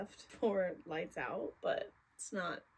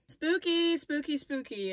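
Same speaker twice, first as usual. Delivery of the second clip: playful spooky; 6 semitones higher, 7 dB louder, slower than usual. Real, not acted.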